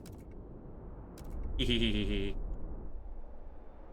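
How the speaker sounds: breathy giggling hee-hee; quick airy giggle fit; cartoon laugh. Real, not acted. Giggles at own joke.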